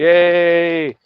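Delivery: a loud, high mock "yay"; mock cheer. Joking about the world applauding, a loud mock cheer.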